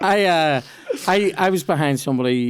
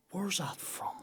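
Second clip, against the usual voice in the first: whisper; 4 semitones higher, 17 dB quieter, slower than usual. Pretend. Mock question to posh stranger.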